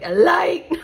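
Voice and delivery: high, loud exaggerated self-mimicry with a laugh; mock intent. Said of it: "said that with such intent".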